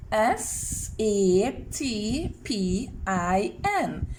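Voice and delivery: each letter name drawn out slowly; slow spelling. Shown as a demonstration. Teacher naming letters for children, slow and drawn out.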